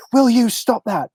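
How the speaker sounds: high-pitched mock scolding mother; imitating mom. Mom telling off her accent-making kids, mock scolding. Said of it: "And she'd be like".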